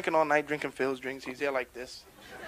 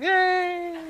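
high, held squeal of delight; drawn-out playful high cheer; mock cheer; 13 semitones higher than usual. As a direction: hoist a little one with a "yay". Mock cheer lifting the president up.

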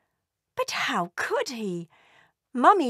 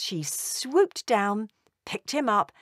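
ordinary delivery, nothing marked

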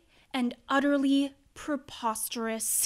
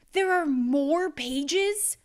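slow dismay; 3 semitones higher, slower than usual. Acted. Reader dismayed by more pages.

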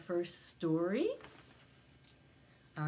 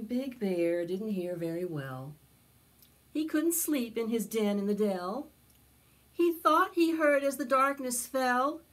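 storybook narration; 4 semitones higher, 7 dB louder than usual. A narrator's reading. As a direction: tell it to a circle of little kids. Storytelling continues.

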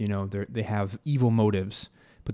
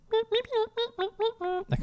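very high, slow squeaky mole voice; pipsqueak voice. Shown as a demonstration. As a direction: squeak it like a tiny chipmunk. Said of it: "these little pipsqueak moles".

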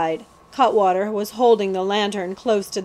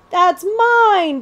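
high, louder plaintive wail; bleating; 10 semitones higher, 7 dB louder than usual. Acted. The prince bleats that the crown is his, high and plaintive. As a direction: wail like a lost lamb. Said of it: "bleated the prince".

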